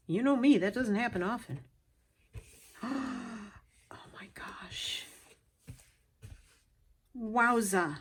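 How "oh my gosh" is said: slow, breathy whisper of wonder; awed whisper. Real, not acted. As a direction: let the wonder steal your voice. Hushed awe after a gasp.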